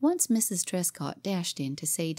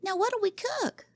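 put-on high, eager child's question; a girl demanding a plan; little girl crying out. Cora asking Jimmy what to cook: eager high child voice. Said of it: "cried little Cora".

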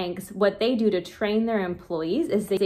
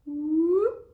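high, bright ooh of delight; 8 semitones higher than usual. Real, not acted. Crafting googly eyes for kids, delighted high ooh. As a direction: coo over the googly eyes.

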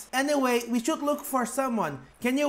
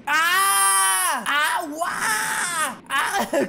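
high, loud wailing scream; hammed-up screaming; 5 semitones higher, 3 dB louder, much slower than usual. Child left alone in the storm, screaming and wailing. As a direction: scream and wail, cracking into a laugh. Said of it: "Can I stop screaming?".

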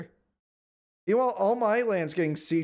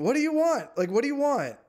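normal talking voice

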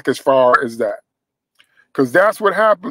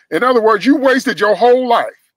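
preaching at full force; 7 semitones higher, 5 dB louder than usual. Real, not acted. Preacher's warning of a wasted life.

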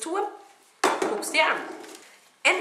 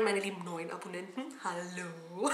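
soft greeting; 5 semitones lower, 10 dB quieter than usual. Real, not acted. Soft greeting to new subscribers.

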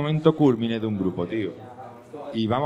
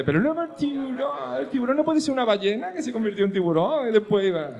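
little Spaniard; 12 semitones higher than usual. Retold or imitated. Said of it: "un españolito y dice".

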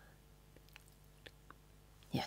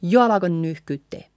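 fast jo alako nyyhkyttee; quick run-together line; at tempo; 4 dB louder, much faster than usual. Shown as a demonstration. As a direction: rattle the phrase off, words joined. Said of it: "A little bit slower".

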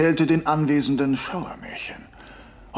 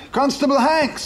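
calling out; 12 semitones higher, 5 dB louder than usual. Acted. Inspector calls constable across pub.

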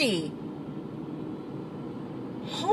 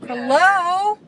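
1 calling out; 6 semitones higher, 4 dB louder than usual. Real, not acted. Calling to a blocking driver.

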